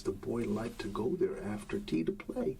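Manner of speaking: plain reading voice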